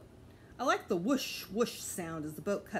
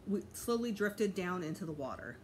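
plain reading voice

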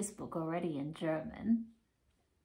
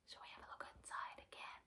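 fast hushed whisper, barely voiced; secret whisper; 23 dB quieter, much faster than usual. Teacher inviting children to peek, whispering fast. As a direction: let them in on a secret.

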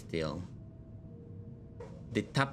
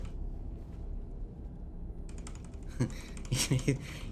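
breathy laugh; 6 semitones higher, 4 dB quieter than usual. Real, not acted. Laughing after plan.

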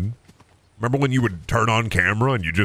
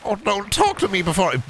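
higher put-on wizard voice; 10 semitones higher than usual. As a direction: whimsical wizard grumble. Keeps the wizard voice going.

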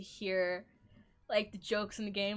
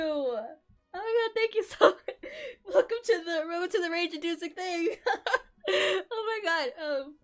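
high, loud laughing near tears; shrieking jackpot winner; 8 semitones higher, 6 dB louder than usual. Real, not acted. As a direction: gush through happy sobs. Still overwhelmed by the raid.

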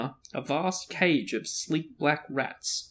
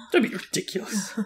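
higher-pitched, laughing repeat; giggly mock rebuke; 6 semitones higher than usual. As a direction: repeat it teasingly through giggles. Reader repeats the line, giggling.

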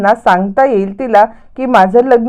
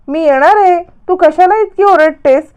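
much higher, acted exasperation; exasperated protest; 8 semitones higher than usual. Vidya asks Priya why she is yelling, exasperated protest. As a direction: protest in a raised pitch.

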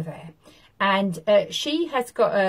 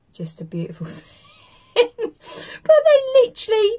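laughing; 7 dB louder than usual. Real, not acted. Laughing at own joke.

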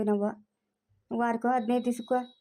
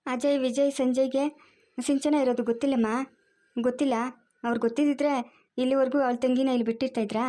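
ordinary talk

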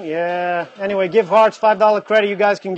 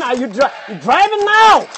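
raised, higher-pitched shouted question; incredulous shout; 4 semitones higher, 4 dB louder than usual. Real, not acted. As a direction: call out the driver in loud disbelief. Scolding a viewer who is driving.

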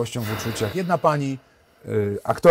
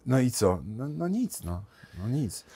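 ordinary talk, nothing marked

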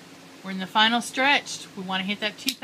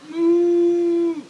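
long loud mooing; loud cow moo; 7 semitones higher, 11 dB louder than usual. Pretend. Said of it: "a moo cow".

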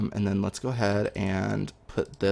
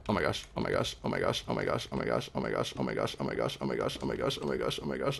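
lower, soft, rapid anxious repetition; panicked muttering; 10 semitones lower, 6 dB quieter than usual. Real, not acted. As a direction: mutter it over and over under your breath. Panicked chant at border.

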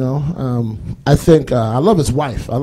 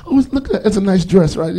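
mock sweet girlfriend voice; 6 semitones higher than usual. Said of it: "couple window shopping".

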